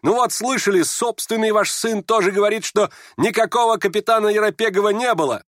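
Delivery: high, triumphant cry; shouting. Acted. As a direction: cry it out high, scoring a point. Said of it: "расхохотался на ответ Гани и прокричал". (торжествующий выкрик)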